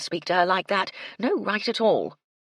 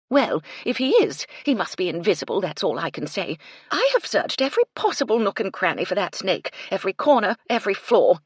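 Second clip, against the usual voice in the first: louder, irritated and impatient; testy; 5 semitones higher, 7 dB louder than usual. A narrator's reading. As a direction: snap back, getting louder. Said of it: "Phoebe said testily".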